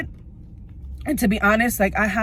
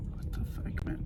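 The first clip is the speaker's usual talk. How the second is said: breathless whispered swearing; nervous whispered curse to herself; 14 dB quieter than usual. Real, not acted. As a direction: hiss it under your breath, rattled. Nervous outburst before appointment.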